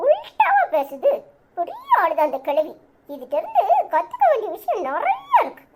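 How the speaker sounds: put-on high childish voice, laughing in admiration; a kid marvelling at grandma's trick; amused child. Child laughing at how grandma twisted the argument, high kid voice.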